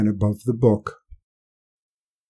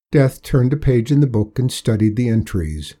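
plain reading voice

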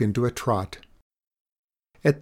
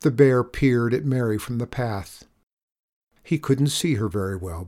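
ordinary delivery

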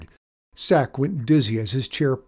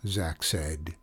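usual speaking voice

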